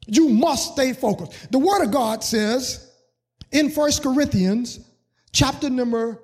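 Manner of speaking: loud, rising preacher's call; preaching at full force. Acted. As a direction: preach it out to the congregation. Preaching builds.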